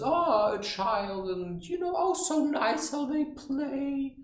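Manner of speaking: put-on high, drawn-out cooing over a child; gushing adult. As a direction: coo in mock delight, high and slow.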